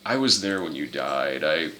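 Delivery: low, hushed, grave confession; mock-solemn quiet admission; Clavius. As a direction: drop it low and soft.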